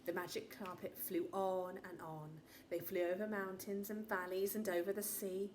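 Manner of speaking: ordinary delivery, nothing marked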